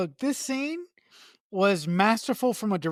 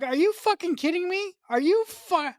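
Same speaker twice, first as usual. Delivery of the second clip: higher, incredulous mock outburst; exasperated outrage; 8 semitones higher than usual. Podcaster fuming at the neighbor leaving the kids, incredulous. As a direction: voice the disbelief, pitched up, half-performed.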